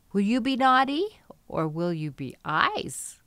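ordinary talk

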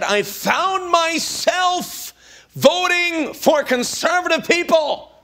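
high, slow dad voice; lightly voiced old dad, slow; dad's voice. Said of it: "That's how he would talk".

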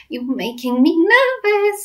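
put-on high, slow girl's voice; drawn-out, high-pitched worry; nervous little girl. Sophie protesting to BFG, nervous girl voice.

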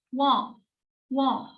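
wo said as u and o quickly; wo enunciated short; syllable demo. Shown as a demonstration. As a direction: model wo twice. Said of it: "Wo diye okuyoruz".

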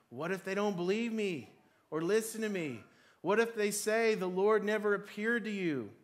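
protesting. Acted. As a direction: plead your case in a panic. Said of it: "Moses protested again".

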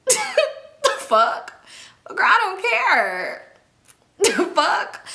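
high, slow, drawn-out scoff with a laugh; scornful disbelief. Real, not acted. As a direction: dismiss her with laughing incredulity. Her scornful reaction to the girl's announcement.

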